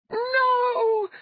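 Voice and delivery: long, very high, loud wail; anguished shriek. A narrator's reading. Undine sees her lover fallen, anguished shriek. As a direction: scream it out in grief, sky-high. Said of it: "Undine shrieked".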